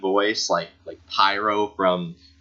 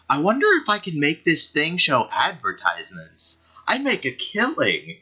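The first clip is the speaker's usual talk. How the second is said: higher, far-off voice, a lightly voiced scheming merc; imitating a character quote; 7 semitones higher than usual. Reading a hero's line aloud, a light higher mock voice. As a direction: toss it off as a sly game character. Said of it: "and he's like".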